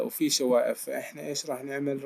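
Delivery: ordinary delivery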